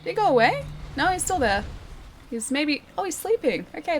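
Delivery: usual speaking voice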